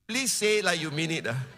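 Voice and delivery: normal talking voice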